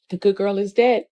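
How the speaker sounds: normal talking voice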